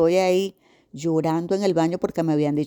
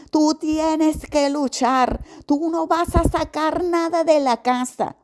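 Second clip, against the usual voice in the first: daughter's voice; 10 semitones higher, slower than usual. Retold or imitated. Daughter scolding her mother.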